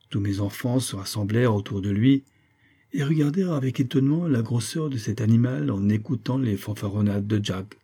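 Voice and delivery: ordinary delivery, nothing marked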